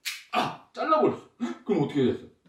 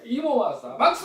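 host announcement; 10 semitones higher, 3 dB louder than usual. Real, not acted. Host announcing magic show to kid.

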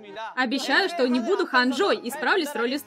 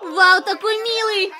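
excited gush; 7 semitones higher, 8 dB louder, slower than usual. Real, not acted. Gushing over cute member.

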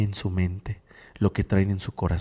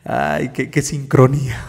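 higher voice breaking out of real laughter; laughing; 6 semitones higher, 4 dB louder than usual. Real, not acted. Host remarking on a funny coincidence, laughing as they speak. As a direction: talk through the tail of a laugh.

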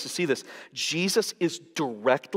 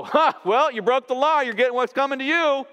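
high, loud sneering taunt; mock the drowning man smugly; hammed-up gloating jeer; callous mockery; 11 semitones higher, 7 dB louder than usual. Mocking callous bystander at drowning.

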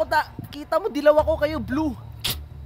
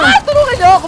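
high, loud plea; urgent high-pitched shout; cry for help; 10 semitones higher, 7 dB louder, faster than usual. Real, not acted. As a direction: yell to teammates for rescue. Calls teammates for help.

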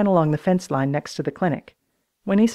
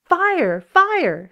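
mock alarm shout; 12 semitones higher, 3 dB louder than usual.

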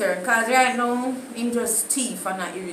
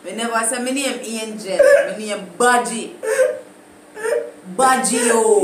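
loud, indignant Jamaican patois protest; laughing patois insistence; 5 dB louder than usual. Acted. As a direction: insist on your name in patois.

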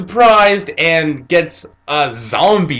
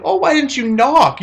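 a much higher, fake-innocent startled cry; mock startled; 11 semitones higher than usual. Old woman caught in the act, faking innocence. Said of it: "Enyaba's like".

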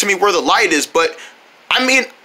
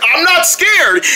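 defensive denial; 9 semitones higher, much slower than usual. Acted. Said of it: "Yeah, you're scared".

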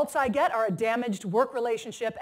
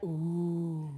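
group ooh; 6 semitones lower, 6 dB quieter than usual. Retold or imitated. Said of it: "And the whole room was like".

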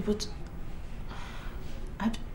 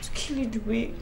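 fearful hush; 4 semitones higher, 10 dB quieter than usual. Acted. Wife's dark fear.

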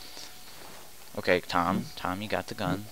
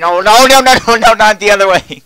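frantic, very high and loud no no no; panicked shout; 12 semitones higher, 14 dB louder than usual. Real, not acted. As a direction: yelp in panic as he closes in. Murderer approaching, panicking.

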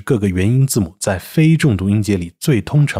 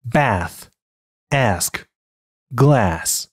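American bath, ask, glass with front "a" as in cat; American English demo of bath, ask, glass; American pronunciation; much slower than usual. Shown as a demonstration. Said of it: "美音".

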